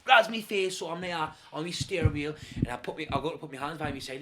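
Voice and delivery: ordinary talk, nothing marked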